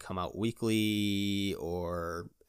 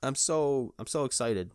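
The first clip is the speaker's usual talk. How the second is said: high, gushing mock excitement; giddy excitement; 8 semitones higher, 4 dB louder than usual. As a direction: act out being too excited for words. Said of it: "my excitement was stupid".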